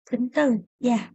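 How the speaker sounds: usual speaking voice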